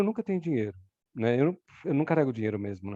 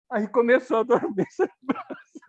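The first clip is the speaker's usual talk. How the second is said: higher voice collapsing into laughter; laughing; 7 semitones higher than usual. Real, not acted. Telling friends the numb arm came back, laughing. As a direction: crack up as you say it. Laughs at own returning numbness.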